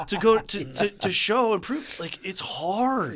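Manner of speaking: high, loud, laughing through the words; laughing, then drawn out. Real, not acted. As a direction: still laughing at the joke, slowing on hard. Laughing after the punchline.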